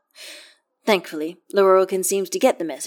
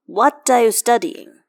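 usual speaking voice

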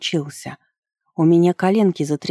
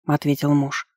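ordinary delivery, nothing marked

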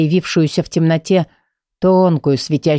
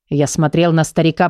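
plain reading voice

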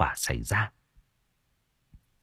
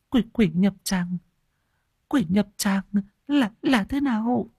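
much higher, breathless stammer; panting in fear; 11 semitones higher than usual. Acted. Old woman hurrying after monk, much higher panting. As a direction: gasp the words as you hurry. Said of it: "vội vàng hổn hển".

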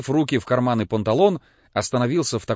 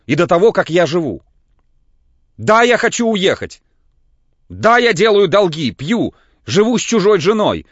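громко и высоко, на грани истерики; кричит, шатаясь от волнения; истеричный крик; 7 semitones higher, 8 dB louder than usual. A narrator's reading. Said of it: "крикнул Лаевский". (истерический крик, высоко и громко)

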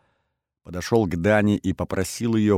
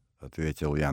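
plain reading voice

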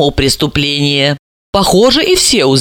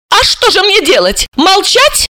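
very high, indignant voice; high indignant woman; heated retort. Acted. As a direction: snap back indignantly. Sima heated argument.

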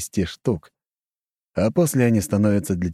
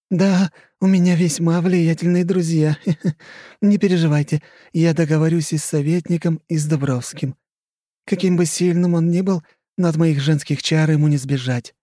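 higher, smug laughing lady; boastful countess; 10 semitones higher, 3 dB louder than usual. Acted.

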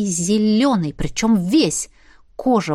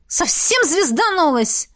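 high, slow startled cry; startled exclaim; 8 semitones higher, slower than usual. A narrator's reading. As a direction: recoil in shock. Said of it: "воскликнула ведьма, шарахаясь от меня". (испуганно воскликнув, отшатываясь)

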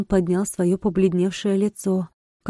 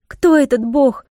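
high, loud, slow cry of defiance; a frantic cry to God's servant; Albina crying out; 6 semitones higher, 6 dB louder than usual. A narrator's reading. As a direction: shout the question at the priest. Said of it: "закричала Альбина".

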